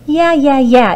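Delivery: wife interrupts the giant's chant; impatient interrupting. A narrator's reading. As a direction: cut him off, bored. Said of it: "interrupted his wife".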